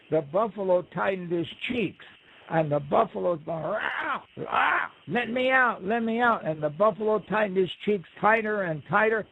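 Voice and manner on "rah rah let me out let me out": high strained rah rah, let me out; trapped cry. Acted. Buzzard stuck inside the buffalo, crying high to get out. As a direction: yell to be freed, pitched up.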